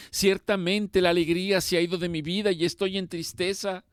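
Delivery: high, loud anguished plea; fervent pleading prayer. Acted. Preacher praying to Jehová, pleading loud and high. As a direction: cry out for mercy, voice lifted high. Preacher prays at full force.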